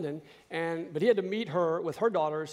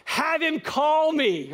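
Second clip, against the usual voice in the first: much higher, slow, loud begging; pleading excitement; 10 semitones higher, 6 dB louder, much slower than usual. Retold or imitated. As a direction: beg for the call, drawn out. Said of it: "texting him, going".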